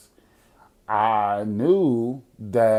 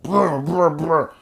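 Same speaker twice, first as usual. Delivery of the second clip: imitated phone buzz, higher pitched; phone vibrating; 5 semitones higher, slower than usual. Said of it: "'cause it's on vibrate".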